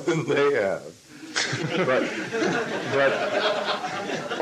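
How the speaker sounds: laughing aside. Real, not acted. Laughs about the students.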